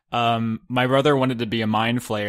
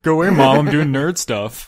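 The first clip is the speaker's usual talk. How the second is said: loud mock brush-off; 4 semitones higher, 8 dB louder than usual. Joking brush-off to mom.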